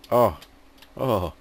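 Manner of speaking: normal talking voice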